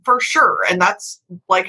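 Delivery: ordinary talk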